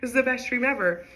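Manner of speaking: high, gushing retold delight; acting out being thrilled; lifted, blissed-out exclamation; loving it. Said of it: "I'm loving the dream".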